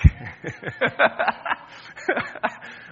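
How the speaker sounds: loud burst of laughter; big laugh at own joke. Real, not acted. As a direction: laugh out loud, high and hard. Jokes about forgiveness count.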